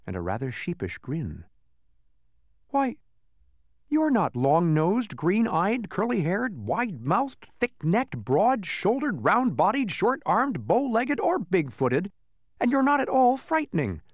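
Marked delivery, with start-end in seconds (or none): 2.7-14.0 high, sing-song debunking list; indignant. Acted. Milo exposing the false monster, high indignation. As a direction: rattle off the features, pitch bobbing up and down. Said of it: "said Milo indignantly".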